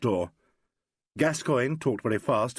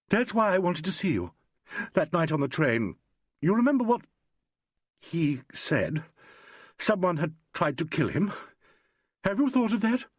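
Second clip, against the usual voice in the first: put-on higher, pressing, insistent young woman; urgent; 7 semitones higher than usual. A narrator's reading. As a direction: push the questions at him urgently. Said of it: "began to speak rapidly, urgently".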